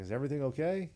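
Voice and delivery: mock-puzzled rising question; concerned question. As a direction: ask with pitch climbing to the end. Retold concerned question to friend.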